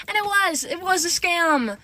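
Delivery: high, loud, drawn-out confirmation; exasperated emphasis. Real, not acted. Storyteller admitting she got scammed, exasperated.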